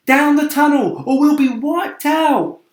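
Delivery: loud high urgent call; urgent cry. Acted. James warning the others of the hail, loud urgent cry. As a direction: shout it in alarm.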